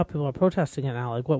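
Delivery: usual speaking voice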